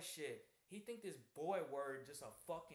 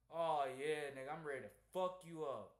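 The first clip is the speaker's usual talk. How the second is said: heated loud reaction; 8 dB louder than usual. Real, not acted. Reacting to being called boy.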